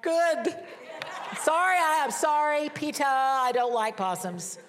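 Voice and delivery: high, loud, laughing outburst; laughing blurt. Retold or imitated. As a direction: blurt it out, then half-apologize with a laugh. Retold own reply, laughing.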